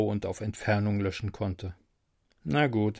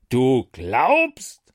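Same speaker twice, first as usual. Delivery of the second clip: loud booming question; thundering; 6 dB louder than usual. Acted. Angry angel thundering at his drunk friend, loud. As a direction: roar it at him. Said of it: "donnerte Wendy".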